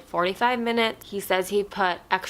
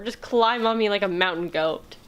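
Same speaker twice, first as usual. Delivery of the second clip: normal talking voice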